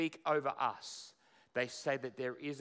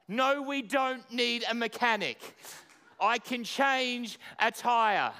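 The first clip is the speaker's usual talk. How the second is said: hammed-up boast; 8 semitones higher, 7 dB louder than usual. Husband telling wife he can change a tire, hammed-up boast. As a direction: puff up like a proud handyman.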